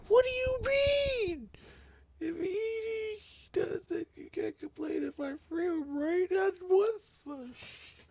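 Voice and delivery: very high, slow squeal of disbelief; shocked squeal. Real, not acted. Reader reacting to a manga reveal, shocked squeal. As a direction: squeak it out, stretched and incredulous.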